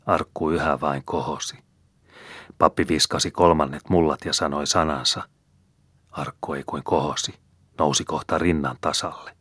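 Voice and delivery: ordinary delivery, nothing marked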